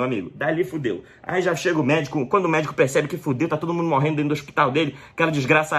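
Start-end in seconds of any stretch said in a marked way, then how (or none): none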